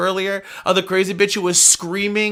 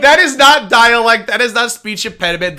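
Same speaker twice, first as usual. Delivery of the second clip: emphatic rebuttal; 5 semitones higher, 7 dB louder than usual. Real, not acted.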